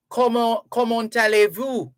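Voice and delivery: "Comment, comment allez-vous?" said the French way, comment with silent final t, allez as a-lay; French phrase. Shown as a demonstration. Host teaching listeners a French greeting, careful French. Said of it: "because in French".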